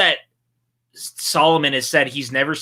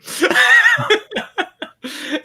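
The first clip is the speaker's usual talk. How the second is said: loud laugh; 4 dB louder than usual. Real, not acted. Host laughs at the scene.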